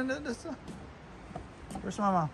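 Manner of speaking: ordinary talk, nothing marked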